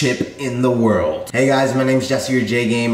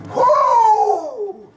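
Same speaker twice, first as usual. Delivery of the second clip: pained woo from spicy heat. Real, not acted. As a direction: blow out the burning heat. Exhaling at the heat.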